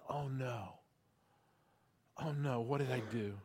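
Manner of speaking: hushed, low dismay; Peter. Retold or imitated. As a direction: sink into quiet, dawning regret. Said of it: "if you read between the lines, you can almost feel it".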